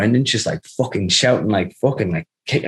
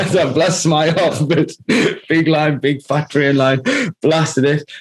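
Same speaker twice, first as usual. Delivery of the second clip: higher, words bubbling through laughter; laughing while talking; 5 semitones higher, slower than usual. Real, not acted. Friend recounts snorting the big line, cracking up.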